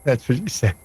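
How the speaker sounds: normal talking voice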